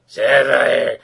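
a loud, drawn-out call of her name; monster bellow. Retold or imitated. Monster climbs the building calling her.

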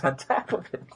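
breaking into laughter. Real, not acted. Host recounts the absurd rocket plan, breaking into laughter. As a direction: lose it at the absurdity as you go.

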